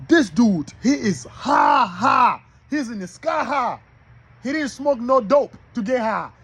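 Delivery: high, excited exclamations, stretched HIGH; hyped-up preaching. Real, not acted. Preacher marvels to brothers at Job vibrating high, excited. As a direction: hype him up, pitch soaring on each high.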